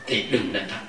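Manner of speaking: ordinary delivery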